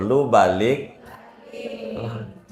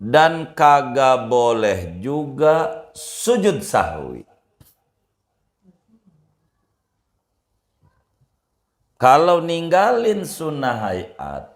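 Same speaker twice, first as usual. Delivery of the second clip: high, slow, raised preaching voice; slow high sermon delivery; emphatic preaching; 8 semitones higher, 3 dB louder, slower than usual. Acted. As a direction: drive the rule home to the congregation, slowly. Preacher states the rule emphatically.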